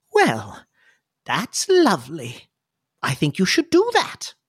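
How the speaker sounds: put-on kindly older lady, pitch swooping up; Clarity. Older lady nods to young patient, kindly lilting approval. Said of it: "and she says".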